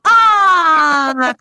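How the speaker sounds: loud, crying disappointed groan; disappointed crowd groan. Retold or imitated. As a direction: groan as she backs away. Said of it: "everyone goes".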